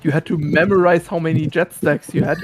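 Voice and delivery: ordinary talk, nothing marked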